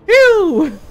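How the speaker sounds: big relieved exhale; loud relieved phew. Real, not acted. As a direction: blow out in relief. Relief after escape.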